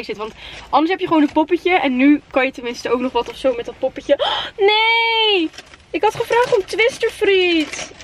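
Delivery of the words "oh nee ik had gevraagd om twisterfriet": whiny disappointment. Real, not acted. Disappointed at missing fries.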